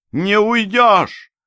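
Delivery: high, drawn-out cry of triumph; triumphant shout. A narrator's reading. Killer cornering the escaping narrator with a revolver, triumphant shout. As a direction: shout it slowly, high and gloating. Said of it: "торжествующе выкрикнула она".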